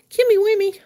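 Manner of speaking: very high, loud sing-song nickname; playful squeal. Real, not acted. Streamer teasing viewer with a rhyming nickname, playful squeal. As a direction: tease affectionately in a high little voice.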